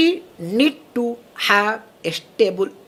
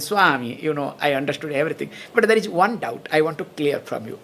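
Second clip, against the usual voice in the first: lower, fast mimicry of a Western professor's accented English; imitating the professor's accent; 8 semitones lower, 4 dB quieter, faster than usual. Mimicking the visiting professor's accent for the class, lower and fast. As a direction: mimic his foreign accent, lower and quick. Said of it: "But because in his accent".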